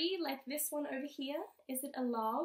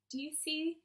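usual speaking voice

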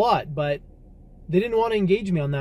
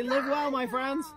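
grand farewell; 9 semitones higher, 6 dB quieter than usual. Shown as a demonstration. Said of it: "Eva, say".

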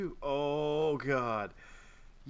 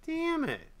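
1 frustrated shout; 14 semitones higher, much slower than usual. Real, not acted. Frustrated gamer fails.

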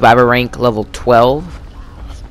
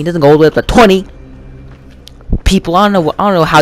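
exasperated rant; 5 semitones higher, 4 dB louder than usual. Real, not acted. Ranting at partner over fake time.

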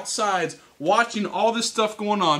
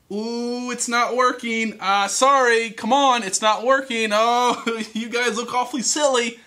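higher, mock-sorry singsong jeer; playful provoking with a laugh; teasing taunt; 4 semitones higher than usual. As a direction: needle them as their effort flops. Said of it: "starts provoking them and teasing them".

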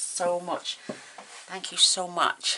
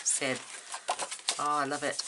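ordinary talk, nothing marked

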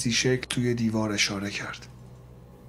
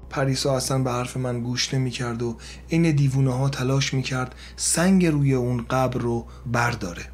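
ordinary delivery

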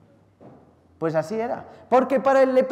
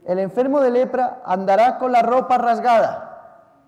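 slow, louder, higher proclaiming of the verse; solemn scripture quote; 5 semitones higher, 6 dB louder than usual. Acted. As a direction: read the law out slowly and gravely.

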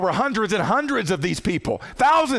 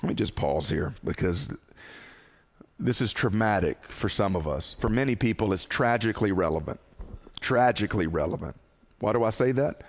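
gentle pause; 9 semitones lower, 4 dB quieter than usual. Real, not acted. Pausing for the traumatic topic.

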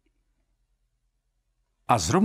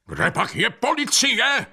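high, raised-voice demand; high, agitated shouted question; calling out; 10 semitones higher than usual. A narrator's reading. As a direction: call over the crowd for help. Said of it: "volal do toho ostrohradský".

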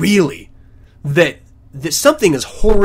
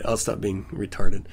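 usual speaking voice

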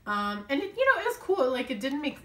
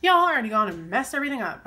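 loud, quick, higher venting; exasperated outburst; 4 semitones higher, 11 dB louder, faster than usual. Real, not acted. Crafter venting to viewers over a mess-up, exasperated. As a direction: burst out fast and loud in frustration. Vents after own writing mistake.

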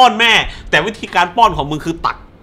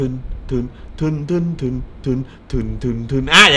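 hummed thriller beat, repeated; humming suspense music; 5 semitones lower than usual. Shown as a demonstration. Explaining suspense pacing, hums a tense film beat. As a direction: hum a tense Mission Impossible beat. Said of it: "อย่างเงี้ย".

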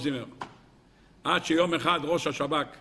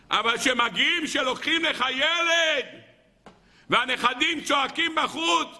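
impassioned preaching; 8 semitones higher than usual. Acted. Preacher tells congregation no man withstands this, fervent. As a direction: preach it with full force.

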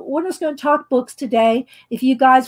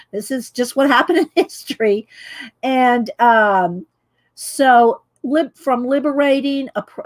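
ordinary talk, nothing marked

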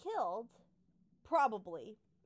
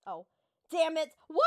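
ordinary talk

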